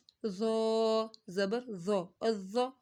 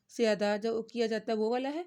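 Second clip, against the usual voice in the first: ordinary delivery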